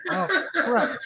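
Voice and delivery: high, laughing mock dismay; laughing oh crap. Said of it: "I'm like".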